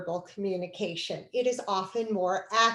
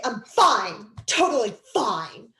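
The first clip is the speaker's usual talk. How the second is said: louder, sing-song fake fine, rising on totally; 6 dB louder than usual. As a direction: play the partner insisting they're fine. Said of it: "they say".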